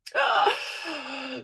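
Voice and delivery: a real teary, breaking sigh; shaky teary sigh. Real, not acted. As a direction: let a tearful breath out. Emotional moment before the ultrasound.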